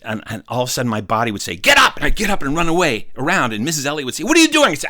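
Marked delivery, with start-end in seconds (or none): 1.5-2.0 high, loud urgent order; sudden command. Acted. Body orders him up. 4.2-4.7 very high, louder teacher voice; exasperated high-pitched demand; scolding teacher. Acted. Teacher scolds restless pupil.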